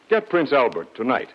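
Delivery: normal talking voice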